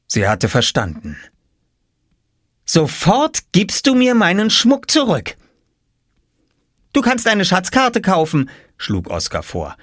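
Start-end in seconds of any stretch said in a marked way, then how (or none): 2.6-5.4 put-on high, indignant girl's voice; angry demand. 6.9-8.5 put-on high child's voice; boy salesman. Boy makes his offer.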